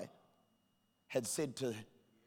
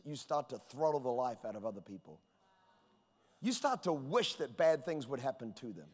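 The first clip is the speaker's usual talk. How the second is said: ordinary talk, nothing marked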